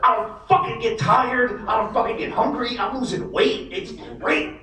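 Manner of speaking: hammed-up giddy dad bragging; imitating hyped dad. Dad voiced, pumped on meds.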